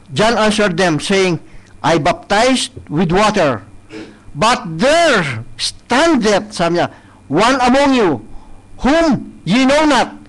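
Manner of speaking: slow, proclaimed Bible verse; solemn, drawn-out proclamation; scripture reading. Acted. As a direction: declaim the verse to the hall. Reads verse slowly and loudly.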